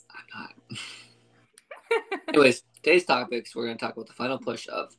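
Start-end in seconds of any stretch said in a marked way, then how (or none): 0.1-0.5 whispering. Real, not acted. Whispered aside.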